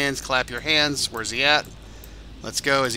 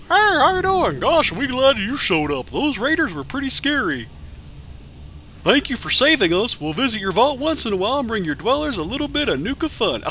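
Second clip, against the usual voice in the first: cheery robot character voice; 8 semitones higher than usual. Pretend. Voicing the game characters thanking the vault overseer, high cartoon cheer. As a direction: play the grateful game buddy thanking the hero.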